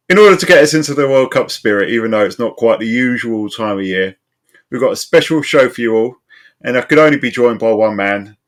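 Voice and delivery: high, loud podcast host welcome; show opener. Acted. Host greeting a worldwide audience, high-energy show opener. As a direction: announce the show to listeners with gusto.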